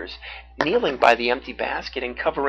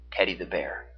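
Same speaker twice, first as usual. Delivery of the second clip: usual speaking voice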